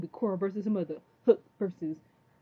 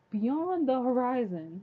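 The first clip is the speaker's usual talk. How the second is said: drawn out, higher and louder; dramatic reveal; 6 semitones higher, 5 dB louder, much slower than usual. Real, not acted. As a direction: stretch it out like a grand announcement.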